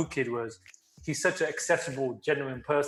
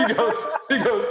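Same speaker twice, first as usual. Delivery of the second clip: laughing; 8 semitones higher, 4 dB louder than usual. Real, not acted. Laughing while telling story.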